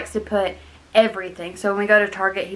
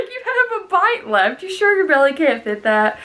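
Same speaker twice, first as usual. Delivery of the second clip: mocking; 8 semitones higher than usual. Mocking someone leaving one bite.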